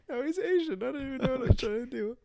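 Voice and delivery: high-pitched, laughing protest; flustered laughing. Real, not acted. Explaining the botched doctor accent to co-host, flustered and laughing. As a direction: squeal it out through leftover laughter.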